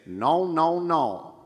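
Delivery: high, loud nasal no; nasal twang. Shown as a demonstration. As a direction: put a bright twangy nasal ring on it. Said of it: "go to that French nasal lilt or that Nashville twang and say".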